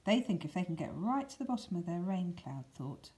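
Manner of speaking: usual speaking voice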